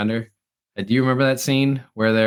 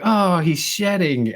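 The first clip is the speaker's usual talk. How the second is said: retold realization; 8 semitones higher, 4 dB louder, slower than usual. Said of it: "And he's like".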